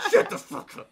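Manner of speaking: high, loud shout with a laugh; teen yelling at a friend who teases him, laughing; mock yell. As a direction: bark back at the teasing friend, hammed-up. Snapping at teasing friend.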